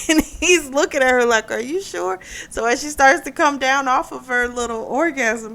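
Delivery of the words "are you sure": higher, soft asking voice; a touch of worried host; mild concerned owner. Retold or imitated. Restaurant owner asking the woman, lightly voiced concern.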